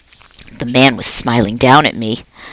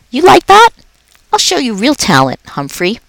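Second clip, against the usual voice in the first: friendly, higher, lively janitor voice; a jolly night janitor chatting to a pet; upbeat, chatty custodian; Aldo the cheerful janitor; 8 semitones higher than usual. Acted. Aldo showing off.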